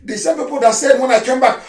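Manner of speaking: usual speaking voice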